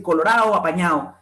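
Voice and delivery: COLORADO and APAÑADO both ending in -AO; dropped D. Shown as a demonstration. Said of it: "la retirada de la D intervocálica".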